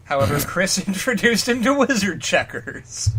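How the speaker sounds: normal talking voice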